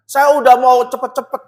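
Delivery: ordinary delivery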